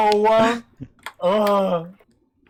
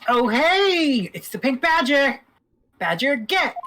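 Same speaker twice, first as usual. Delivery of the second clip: excited; 9 semitones higher than usual. Acted. Character spots a badger.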